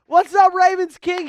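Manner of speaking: high, loud hype greeting; hyped shout-out. Real, not acted. Host hypes a viewer in the chat, loud and high. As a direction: call it out to the viewer with energy.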